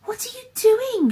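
high-pitched, frantic cry; desperate wailing; panicked wail. A narrator's reading. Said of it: "she wailed".

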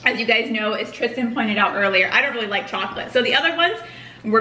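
talking between bites; chewing while speaking; mouth full of cookie. Real, not acted. Seen 0: Woman holds cookie at mouth, bites it at +1.1 mid-sentence.

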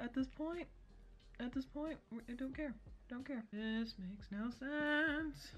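lips stiff under mask paste; talking while spreading mask; talking with face mask on. Real, not acted. Seen 0: Thick orange mask paste covers face and around lips while spreading it.

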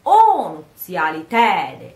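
"o nuziali tede" with "o" and "tede" loud and long, "nuziali" quick and unstressed; sentence stress. Shown as a demonstration. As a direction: lean on "o" and "tede", skim over "nuziali". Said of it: "the stress of the sentence is on".

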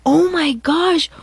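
slow, high, exasperated groan of disbelief. Real, not acted. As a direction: stretch it out in disbelief. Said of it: "reacting to the post".

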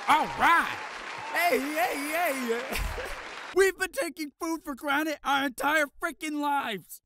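loud, high hyped-up announcement; excited shouting. Acted. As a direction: pump the crowd up loudly.

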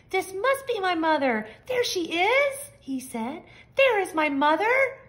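high, loud and eager; excited baby bird. A narrator's reading. Baby bird thinks the big thing is mother, high and eager. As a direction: sure you've found her at last.

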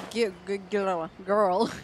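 ordinary talk

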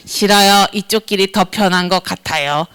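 lightly voiced low, sulky refuser; stubborn refusal. Acting people refusing God's way. (낮은 목소리로 고집부리듯)

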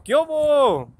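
high, loud answering holler; drawn-out high call; calling back. Retold or imitated. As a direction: shout back to a pal, last vowel stretched. Calling back to friend outside.

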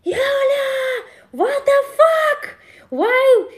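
high, loud shout of "Julia, what the fuck"; screaming at a friend who keeps messing up; retold furious yell. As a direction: yell at your friend in pure frustration. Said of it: "And I was yell at her like".

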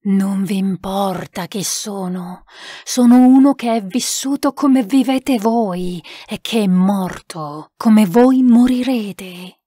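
slow, weighty spirit voice; solemn spirit. Acted. Spirit answers a sitter asking who it is, slow and solemn. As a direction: answer slowly from beyond, drawing out the words.

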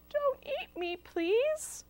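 very high, tiny begging voice; pleading little pizza. Acted. Pizza begging not to be eaten, high pleading voice.

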